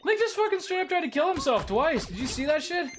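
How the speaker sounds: excited. Real, not acted. Amazed at game death.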